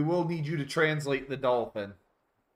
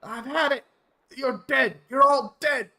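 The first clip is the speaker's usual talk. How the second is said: high, loud villainous shouting; enraged dolphin; 13 semitones higher, 9 dB louder than usual. Acted. Dolphin turning on the whole party, hammed-up rage. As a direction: build to a furious mock yell. Dolphin snaps in acted fight.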